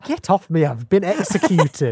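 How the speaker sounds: loud, high, slow theatrical complaint; mock indignant protest. Fan acting executed Missy being moved, melodramatic protest.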